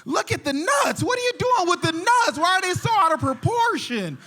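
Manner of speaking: high, loud, outraged father rant; mad dad. Acted. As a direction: shout it like an appalled dad. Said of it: "the dad gets mad".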